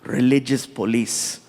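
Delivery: low, deliberate, gracious prince; prince's voice. Retold or imitated. Prince praising the shows to the comic, low and deliberate. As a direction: keep it deep and measured, with weighty pauses. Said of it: "He's like".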